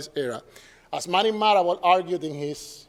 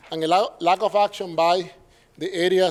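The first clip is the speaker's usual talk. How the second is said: normal talking voice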